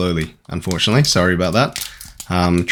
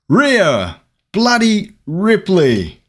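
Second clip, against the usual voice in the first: high, slow, put-on Australian announcing; big theatrical Australian-accent reveal; 10 semitones higher, much slower than usual. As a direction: draw each word out in hammed-up Australian. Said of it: "Australian accent when she says bloody".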